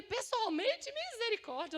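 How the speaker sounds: high, loud incredulous echo, rising; alarmed disbelief. Retold or imitated. Woman balking at advice to speak in person, alarmed disbelief. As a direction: throw the word back in mock horror.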